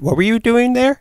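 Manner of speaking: high-pitched, playful mock-nosy question; teasing question. Same question asked twice, teasingly.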